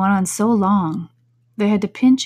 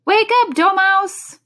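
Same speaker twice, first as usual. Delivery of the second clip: high, loud wake-up call; 12 semitones higher, 8 dB louder, slower than usual. Acted. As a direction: shout to wake the sleeper. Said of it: "they both cried".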